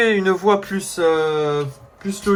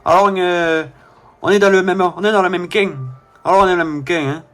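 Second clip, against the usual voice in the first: cliché southern French accent; accent du sud. Shown as a demonstration. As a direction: try on a southern French twang. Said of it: "je vais faire l'accent du sud". (accent du sud de la France cliché)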